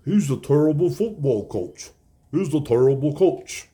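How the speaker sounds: mock pundit voice, lightly put on; a light send-up of a TV pundit; mimicking Rob Parker. Sports podcaster imitating pundit Rob Parker's take. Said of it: "That's the way he talks".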